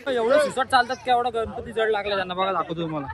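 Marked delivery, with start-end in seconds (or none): none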